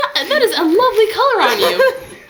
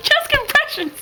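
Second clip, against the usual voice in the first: high-pitched excited squeal; giddy, high exclamations; mock triumph; 6 semitones higher, much slower than usual. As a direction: cheer a miracle revival in a high voice. Mock CPR revival celebrated.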